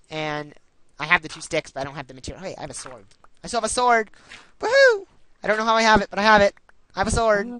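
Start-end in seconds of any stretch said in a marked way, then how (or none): 3.4-4.1 loud excited cheering over the sword. Real, not acted. Excited finding sword in game. 4.6-5.1 high loud woohoo cheer; whoop. Real, not acted. Cheering at found sword. 5.4-7.5 loud excited cheering over the sword. Real, not acted. Excited finding sword in game.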